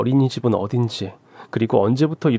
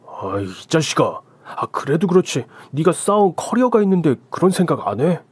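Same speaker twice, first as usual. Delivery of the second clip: higher, rising reproach from a pal; exasperated friend; 5 semitones higher than usual. Acted. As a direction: scold an old friend in frustration. Friend scolds in argument.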